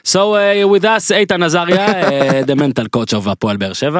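put-on show-host intro in heavy Israeli accent. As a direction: hardcore Israeli accent English, announcer style. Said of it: "אעשה דווקא מבטא ישראלי הכי הארדקור".

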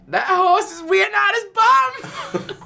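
squeaky, slow joke line; very high silly voice. Acted. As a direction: squeak it out slowly.

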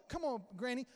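normal talking voice

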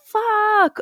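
sudden high mock "Fuck"; mock panicked curse. [name] swearing on hearing bad news, mock panic.

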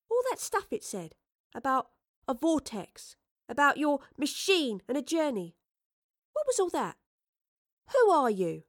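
put-on high-pitched girl's questioning; curious, demanding Astrid. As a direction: press for answers in a high young voice.

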